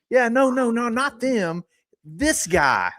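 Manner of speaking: high, drawn-out put-on voice; mock insistent correction. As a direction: replay the silly insistence, high.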